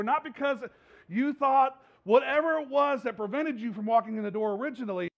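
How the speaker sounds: rising preacher's fervor, "Come to Jesus" slowed right down; preaching at full force. Acted. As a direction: drive the Easter message home from the pulpit. Easter appeal at full force.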